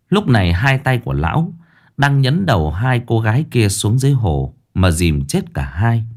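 usual speaking voice

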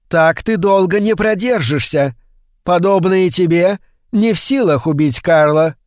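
a lightly voiced threatening witch; menacing witch voice. Mrs Brown threatens Brian.

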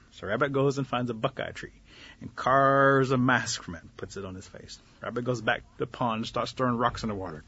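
usual speaking voice

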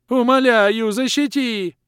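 high, loud creature howl; howling plea. Acted. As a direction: howl for help. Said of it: "продолжал вопить Лохр".

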